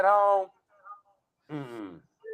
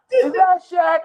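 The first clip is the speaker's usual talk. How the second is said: imitating a kid; 9 semitones higher, 5 dB louder, slower than usual.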